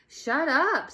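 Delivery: loud, high scream. A narrator's reading. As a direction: scream it at her. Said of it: "screamed Jeff".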